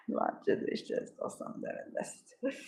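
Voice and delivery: soft whispered aside; breathy, voiceless whisper. Real, not acted. Aside about loving magic.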